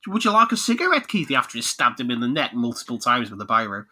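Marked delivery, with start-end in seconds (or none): 0.1-1.3 high, casual Australian offer; Australian accent. Acted. Chopper to Keithy after stabbing him, Australian accent. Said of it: "Oh, Australian".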